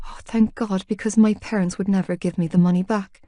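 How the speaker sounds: ordinary delivery, nothing marked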